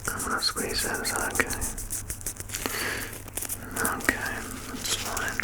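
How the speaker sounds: soft breathy whisper, close to the ear; ASMR whisper. Acted. Clinician checking the patient's ears, whispered softly. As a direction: whisper gently like a careful clinician.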